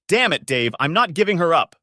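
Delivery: loud, high shouted refusal. A narrator's reading. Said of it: "Linda shouted".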